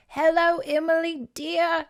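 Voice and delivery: high-pitched British great-aunt greeting; hammed-up British auntie, doting hello; bad British accent. Great aunt greeting Mandy's mother, bad British accent.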